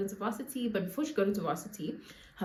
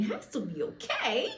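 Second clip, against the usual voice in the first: laughing; 5 semitones higher than usual. Acted. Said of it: "my darling".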